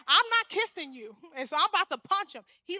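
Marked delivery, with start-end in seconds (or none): 0.1-1.2 louder, firm no; stern defiant no; firm refusal. Retold or imitated. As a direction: plant your feet and refuse. Refusing the kiss.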